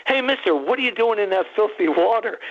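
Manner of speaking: a hint of a kid calling out to a stranger; young kid. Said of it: "twelve or thirteen years old".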